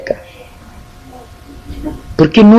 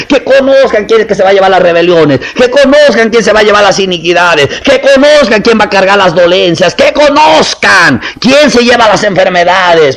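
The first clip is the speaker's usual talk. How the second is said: building preaching; 6 semitones higher than usual. Acted.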